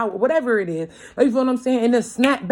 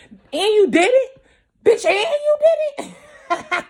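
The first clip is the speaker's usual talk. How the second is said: laughing disbelief; 13 semitones higher, 5 dB louder, slower than usual. Acted. Mock disbelief at their success.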